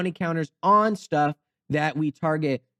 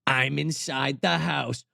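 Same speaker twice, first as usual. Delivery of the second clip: slow, low raspy phone voice; raspy killer voice; 4 semitones lower, slower than usual. Retold or imitated. Killer on the phone taunting the cheerleader, slow and raspy. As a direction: drag it out slowly like a masked killer on the line. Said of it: "It's a raspy voice on the other end".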